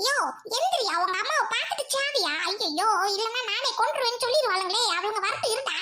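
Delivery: normal talking voice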